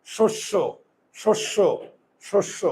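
"শস্য" repeated softly as shosh-sho, pausing between; closed-jaw pronunciation demo. Shown as a demonstration. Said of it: "আমার মুখের চোয়াল খোলে না". ("শস্য" চোয়াল না খুলে, আস্তে)

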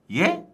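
"예?" pitched high with a questioning rise; 되묻는 예?. Retold or imitated. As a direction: throw back a high, questioning "예?". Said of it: "이렇게 했단 말이야". ("예?" 높게 올려 되묻기)

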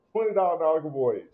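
loud incredulous repeat of the price; emphatic repeat. Real, not acted. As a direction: hammer home how cheap it is.

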